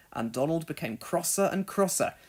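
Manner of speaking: ordinary delivery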